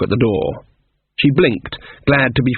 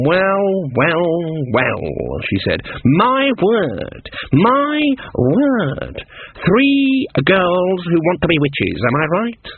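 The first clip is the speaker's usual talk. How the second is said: higher, slow mocking crone; sizing up three girls with scorn; old witch drawing out each word mockingly; sardonic old witch; 5 semitones higher, much slower than usual. Granny inspects would-be witches.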